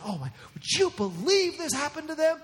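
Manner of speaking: high, breathless gossip voice; gossipy mock shock. Gossiping to her girlfriends about a scandal, mock-shocked. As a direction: imitate a busybody relishing the scandal.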